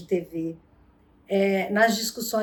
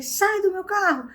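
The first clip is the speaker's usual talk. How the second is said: angry order; 10 semitones higher than usual. Retold or imitated.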